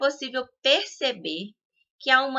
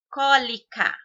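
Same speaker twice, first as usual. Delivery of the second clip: Cólica with CÓ punched louder; stress on first syllable; 3 semitones higher, 5 dB louder than usual. Shown as a demonstration. Teacher giving a proparoxytone example, stress on CÓ in Cólica. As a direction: hit the Có- of Cólica. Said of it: "có é mais forte".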